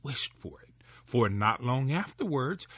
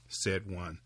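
plain reading voice

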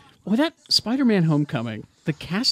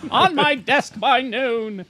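higher, slow demanding boss bark; barking newspaper editor; 7 semitones higher, much slower than usual. Retold or imitated. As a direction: bark the deadline like a tabloid editor. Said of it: "best Nathan Lane impressions".

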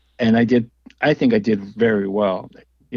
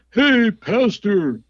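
[name] calling out; 10 semitones higher than usual. Retold or imitated. Friend spotting him backstage and calling out to everyone, playful hail. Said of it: "And it's [name]".

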